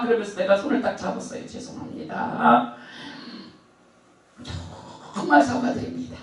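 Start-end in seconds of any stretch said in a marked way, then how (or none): 1.5-6.2 slow, hushed, almost whispered sorry; soft apology. Retold or imitated. Apologizing to the angry neighbor woman, soft and slow. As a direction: breathe the apology gently. Apologizing while holding her hand.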